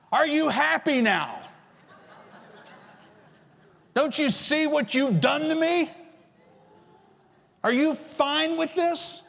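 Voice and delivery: high, strained mock yell at God. Preacher reenacting yelling at God, high and strained. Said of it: "I was yelling at God".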